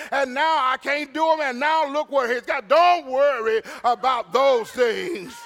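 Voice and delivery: loud, high-pitched pulpit delivery; ringing sermon voice with amens; full-force preaching. Acted. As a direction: preach it hard, punching the key words.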